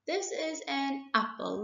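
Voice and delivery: usual speaking voice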